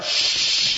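long drawn-out mock shush. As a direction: shush someone in a library. Acted library shushing.

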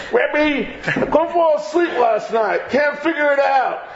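puzzled student pleading to his rebbe, hammed up; complaining student.

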